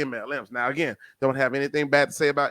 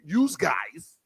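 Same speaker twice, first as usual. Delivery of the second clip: New Jersey accent. Shown as a demonstration. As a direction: drop into a Jersey accent for "yous guys". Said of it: "in my New Jersey accent".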